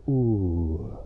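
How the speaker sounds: a far-off hammed-up ooh; mock ooh effect. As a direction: coo a fake sound effect.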